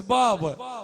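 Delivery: loud, drawn-out retold call; child crying out. Daughter calls her father as she is taken away, slow loud cry. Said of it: "الطفلة تصيح".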